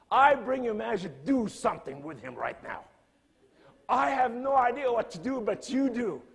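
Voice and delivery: loud, high, fervent prayer; praying over Majid, desperate and loud; crying out to the Lord. Retold or imitated. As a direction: plead with God at full voice. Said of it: "I started crying out to the Lord".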